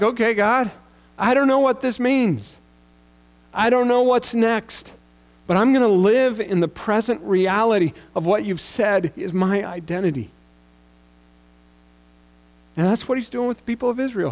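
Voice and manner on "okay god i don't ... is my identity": higher and louder, pouring it out to God; fervent prayer. Retold or imitated. Pastor replaying his bold prayer to God on the bus. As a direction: declare it with conviction.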